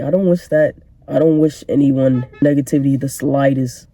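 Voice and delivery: usual speaking voice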